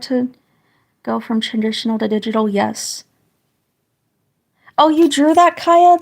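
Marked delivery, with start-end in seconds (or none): 4.8-6.0 delighted high gasp of surprise; suddenly thrilled, voice jumps up; surprised. Real, not acted. Surprised at viewer's drawing.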